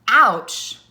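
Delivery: loud, sharp cry of pain; yelled ouch. Shown as a demonstration. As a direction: shout it out like a stubbed toe. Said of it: "Lucy yelled".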